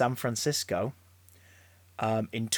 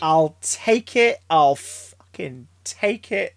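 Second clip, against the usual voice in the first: hammed-up acceptance; 3 semitones higher, 5 dB louder, much slower than usual. Hammed delighted reply to silly line.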